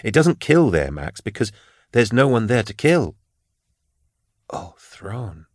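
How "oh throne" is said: breathless whispered oath; murmured. A narrator's reading. Detective murmurs in dread at the pattern. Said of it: "she murmured".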